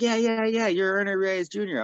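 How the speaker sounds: put-on high, eager kid's chatter. Kids at a tournament asking for an autograph, eager. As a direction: gush like an excited young fan. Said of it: "they're like".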